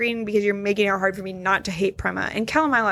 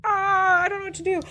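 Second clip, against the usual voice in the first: high-pitched mock panic; panicking graphics; 10 semitones higher than usual. Voicing TV graphics glitching out, high mock panic. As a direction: play a glitching screen in a high, flustered voice. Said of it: "glitch out and be like".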